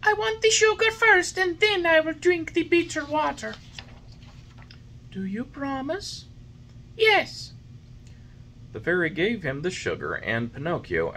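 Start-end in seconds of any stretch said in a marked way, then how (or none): 0.0-3.6 put-on high, loud whiny boy voice; stubborn puppet. A narrator's reading. Puppet refusing the fairy's medicine. 5.1-6.3 high, gentle fairy voice; fairy, patient. A narrator's reading. Fairy patiently coaxing the puppet. 7.0-7.5 put-on high, loud whiny boy voice; stubborn puppet. A narrator's reading. Puppet refusing the fairy's medicine.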